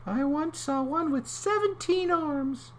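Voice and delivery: very high little voice; comic high clam. Acted. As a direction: squeak it as the clam.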